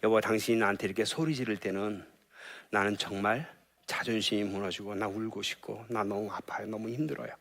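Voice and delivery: soft, tender admission of pain; quiet hurt plea. Shown as a demonstration. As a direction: speak your hurt gently and quietly. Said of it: "그래서 남편에게". (소리 지르는 남편에게 조용하고 여리게)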